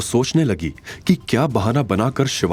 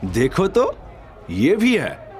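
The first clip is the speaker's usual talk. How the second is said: put-on much higher, sneering older woman's voice; mocking mother-in-law; 6 semitones higher than usual. A narrator's reading. Rekha mocks Avantika. (बहुत ऊँची आवाज़ में ताना मारती सास)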